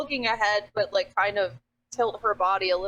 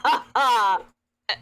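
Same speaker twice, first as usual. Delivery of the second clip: character laugh; 3 semitones higher, 4 dB louder than usual. Acted. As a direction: laugh out loud as Iggy. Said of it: "Iggy looks at it and she goes".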